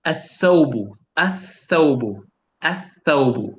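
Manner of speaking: "Athawbu" with a long held th and no L; L silent, th doubled. Shown as a demonstration. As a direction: lean into the th of "Athawbu", skipping the L. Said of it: "You say athawbu".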